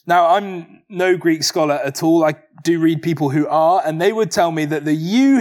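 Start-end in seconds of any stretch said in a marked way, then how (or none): 4.9-5.4 you hit high and loud; emphatic you. Shown as a demonstration. Preacher explaining Greek, stressing you hard. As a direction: punch the word you. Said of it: "here is emphatic".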